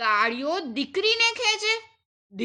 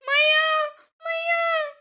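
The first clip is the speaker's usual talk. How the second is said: put-on girl crying for mother; 12 semitones higher, slower than usual. A narrator's reading. The daughter falls and cries for her mother, high call. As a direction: call for mum after a fall.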